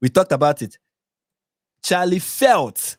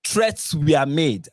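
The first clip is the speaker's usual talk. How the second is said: slow quote; 3 semitones lower, much slower than usual. Retold or imitated. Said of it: "the next thing she said is".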